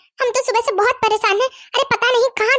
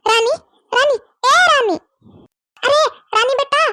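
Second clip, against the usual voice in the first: calling for a lost pet, pitch lifting; 3 semitones higher than usual. Acted. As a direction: call out searchingly, higher on the later calls. Calling out for missing hen.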